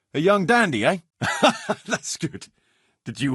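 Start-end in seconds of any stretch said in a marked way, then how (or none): 1.2-1.8 airy chuckle. Acted. Laughs at the dandy.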